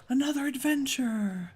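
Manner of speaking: high bright cheer for the trip; excited cheer. Real, not acted. Gamer cheering a new adventure, excited. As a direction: sing out the start of an adventure.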